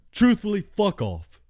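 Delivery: high, loud burst; emphatic outburst. Real, not acted. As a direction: spike the word in exasperation. Emphatic rant.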